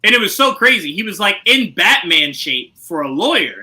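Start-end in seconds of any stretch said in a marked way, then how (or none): none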